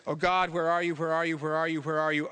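level, insistent calling on God; anxious searching. Acted. People in Scripture searching for God, insistent. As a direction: run the repeated questions together. Searching prayer, question repeated without pause.

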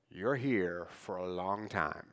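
low, soft and slow; hushed and heavy. Real, not acted. Preacher to exiles, low slow hushed warning. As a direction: let the long exile sink in slowly. Tells exiles they will stay.

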